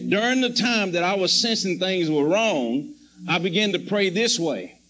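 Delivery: ordinary talk, nothing marked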